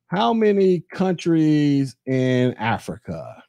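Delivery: drawn-out, careful recount; slow right down to get the number right; slow deliberate question. Real, not acted. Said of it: "let me get my numbers right".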